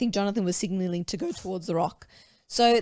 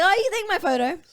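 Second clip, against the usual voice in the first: mock eager self; 8 semitones higher, 4 dB louder than usual. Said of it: "I was like".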